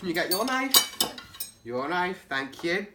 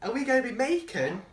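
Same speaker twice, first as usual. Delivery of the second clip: ordinary talk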